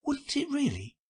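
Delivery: put-on high, astonished girl; genuinely taken aback; great surprise. A narrator's reading. Alice hears fish need porpoises, in great surprise. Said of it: "in a tone of great surprise".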